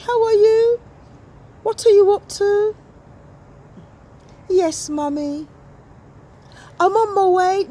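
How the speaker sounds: sweet daughter talking to mum; teen girl on phone. Acted.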